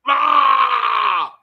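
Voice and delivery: short loud mock cry of being choked; hammed-up choked cry; mock choked yell. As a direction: yelp like you're grabbed.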